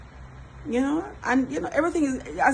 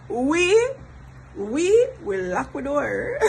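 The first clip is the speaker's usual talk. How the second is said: drawn-out, high and mock-solemn; playful emphatic declaration; 5 semitones higher, much slower than usual. Real, not acted. Vlogger on locking up at home, playfully emphatic. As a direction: declare it slowly, stretching the words between laughs.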